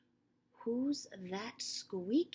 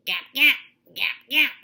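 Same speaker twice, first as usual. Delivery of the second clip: much louder quacking gap; duck quack; 4 semitones higher, 17 dB louder than usual. Acted. Reading to children, loud duck quacks.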